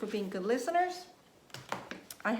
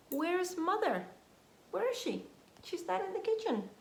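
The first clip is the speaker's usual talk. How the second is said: higher, anxious-sounding search; worried searching; 9 semitones higher, faster than usual. Acted. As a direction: call out, worried for mother.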